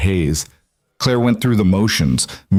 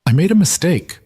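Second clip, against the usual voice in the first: careful, higher-pitched admission; measured and tender, pitched up; 9 semitones higher than usual. A narrator's reading. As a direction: gently confess to a child. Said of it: "Claire said carefully".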